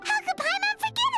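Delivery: high-pitched burst of sudden remembering; excited exclamation. Acted. Suddenly reminded of promised snacks.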